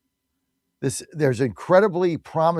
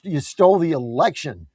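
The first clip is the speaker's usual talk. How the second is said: ordinary talk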